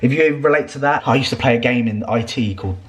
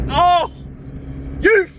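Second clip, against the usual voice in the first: mock road rage yell; slower than usual. Driver yelling at someone who didn't say thanks, mock rage. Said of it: "I'm like that".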